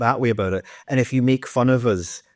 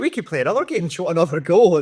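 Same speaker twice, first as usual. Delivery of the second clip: cocky German footballer; 7 semitones higher than usual. Retold or imitated. Voicing fresh German players.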